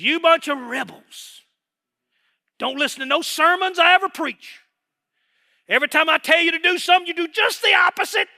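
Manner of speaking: preacher bellowing as an enraged Moses; furious shout. Retold or imitated. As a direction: blow up at the crowd, rising to a shout. Moses yelling at rebels.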